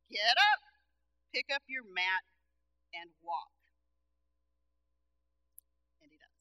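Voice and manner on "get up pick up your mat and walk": commanding Jesus. Acted. Jesus commands the healed man.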